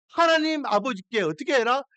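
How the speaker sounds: usual speaking voice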